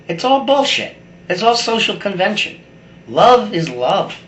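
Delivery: high, loud, fed-up exclamation; heated outburst. Real, not acted. Railing against social convention, a loud high exasperated cry. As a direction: throw it out in exasperation, slowing on the last words.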